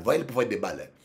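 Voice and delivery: ordinary talk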